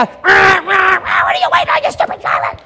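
loud, high road-rage shout; honking-mad shout at a driver who missed the green; angry yell at driver. Retold or imitated. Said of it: "it used to be a".

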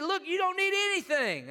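high-pitched, excited kid imitation; excited young son. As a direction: mimic a thrilled boy calling out, high.

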